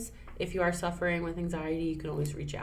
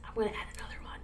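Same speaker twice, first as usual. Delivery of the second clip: whisper; 4 semitones higher, 7 dB quieter, much faster than usual. Real, not acted. Adding one more thing.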